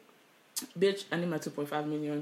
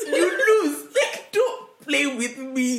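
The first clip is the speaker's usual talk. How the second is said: loud, high, slow taunt through laughter; laughing taunt; 9 semitones higher, 7 dB louder, much slower than usual. Real, not acted. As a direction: gloat while cracking up. Laughing at own revenge fantasy.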